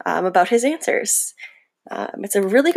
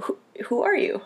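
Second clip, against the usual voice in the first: soft unvoiced whisper; 6 dB quieter than usual. Real, not acted. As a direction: whisper it awkwardly. Awkward whispered aside.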